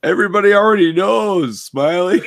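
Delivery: high, teasing shout to a friend; playful high-pitched heckle; mock call-out. Joking call to the retold friend.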